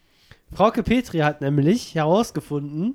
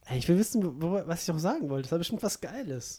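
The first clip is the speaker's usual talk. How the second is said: trailing off; 9 dB quieter than usual. Real, not acted. Forgot what to say.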